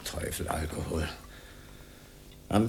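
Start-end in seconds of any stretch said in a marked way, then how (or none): 0.0-1.1 low, soft, ominous aside; a low, grim murmur to oneself; brooding refrain. Acted. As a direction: drop the voice low and mutter it darkly.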